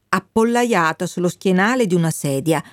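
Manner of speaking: ordinary delivery, nothing marked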